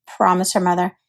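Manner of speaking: ordinary delivery, nothing marked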